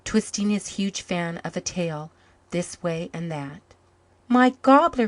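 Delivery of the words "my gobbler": high, loud delighted shout; joyful cry. A narrator's reading. Boy spotting his lost pet turkey, crying out in delight. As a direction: burst out in amazed joy. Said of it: "cried Jims".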